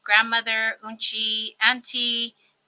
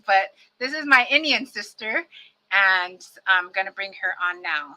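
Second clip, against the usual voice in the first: ordinary delivery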